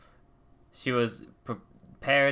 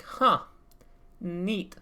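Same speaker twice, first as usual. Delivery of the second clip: unimpressed huh; 6 semitones higher than usual. Retold or imitated. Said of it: "Honor's like".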